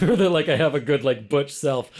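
joking about putting on a macho voice; butch straight voice. Pretend. Queer speaker mocks his own butch voice, hammed up. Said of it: "a good straight voice".

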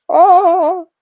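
loud, high drawn-out "oh"; mock panicked wail; loud dismayed cry. Re-enacts panic before saying yes.

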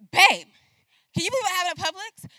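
high, fast, loud outburst; venting to husband. Retold or imitated. Wife venting to her husband on the phone, fast and loud. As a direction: blurt it out all worked up.

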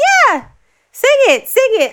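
loud, high, pushy command; mock boss yell. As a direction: bark it like a pushy guest. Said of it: "He's like".

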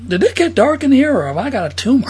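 high start, comic worry; a hint of a panicked comic; mock quote.

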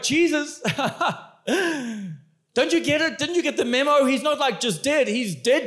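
half-laughing, don't you get it; exasperated Martha. Acted. Martha laughs at Jesus over the four days, exasperated and high. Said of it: "I was like".

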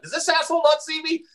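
high, put-on annoyed driver; mock irritated driver. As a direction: squeak it out as the fed-up motorist. Voicing driver during fake-out prank.

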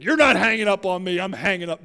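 loud, joking comeback, hammed-up; mock indignant outburst. Preacher joking as someone beating a caller to hanging up, mock indignant. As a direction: burst out in pretend outrage, easing at the end. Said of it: "No, I'm only kidding".

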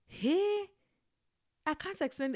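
high, loud cry of disbelief at the pain; overwhelmed outburst. Retold or imitated. Telling friends about labor pain, high loud outburst. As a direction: burst out, the pain beyond words.

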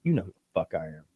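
low, quick, hushed gangster threat. Acted. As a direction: stare him down with a quiet, low voice. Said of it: "staring down like an actual gangster".

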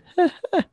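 very high demonstration laugh; high-pitched laugh. Shown as a demonstration. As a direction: show your laugh jumping up high. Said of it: "my voice just gets really high pitched".

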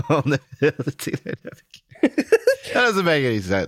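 high, laughing disbelief. Real, not acted. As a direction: giggle through the words. Cracking up at nickname.

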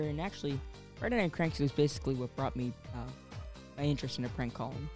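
ordinary talk

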